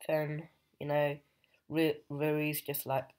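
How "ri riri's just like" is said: ordinary delivery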